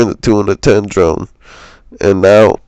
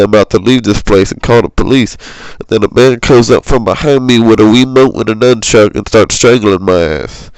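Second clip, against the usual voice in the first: usual speaking voice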